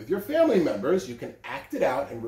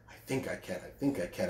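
quiet resolve; 7 semitones lower, 10 dB quieter, faster than usual. A narrator's reading. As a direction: lower and soft. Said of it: "Then she said".

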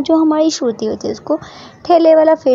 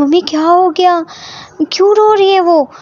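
alarmed; 7 semitones higher, 4 dB louder than usual. Acted. Worried question on call.

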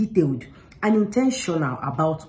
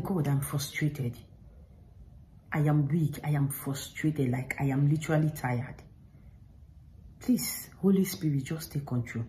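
weary quiet prayer; 3 semitones lower, 7 dB quieter than usual. Retold or imitated. Retold prayer when frustrated.

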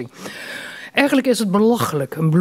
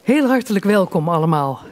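normal talking voice

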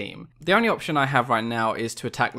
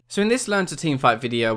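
ordinary talk, nothing marked

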